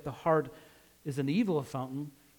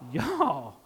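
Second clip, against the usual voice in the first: drawled Southern "Y'all", pitched up and falling; Southern US accent; 5 semitones higher than usual. Shown as a demonstration. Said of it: "if you're in the southern states, what do they say?".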